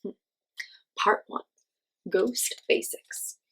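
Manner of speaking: usual speaking voice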